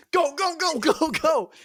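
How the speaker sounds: high, loud urging with a laugh; urgent mock shout. Odysseus urging his crew to flee, frantic shouting. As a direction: drive them out in comic panic.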